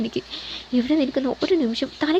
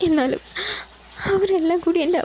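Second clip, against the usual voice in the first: tearful, choked; 6 semitones higher than usual. A narrator's reading. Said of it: "പറയാനാവാതെ കരഞ്ഞുകൊണ്ട്".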